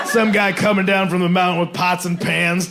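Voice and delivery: usual speaking voice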